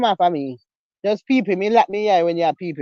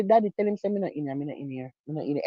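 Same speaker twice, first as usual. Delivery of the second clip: hushed hiding plea; 6 semitones lower, 10 dB quieter, faster than usual. Retold or imitated. Hiding from neighbor, asks father.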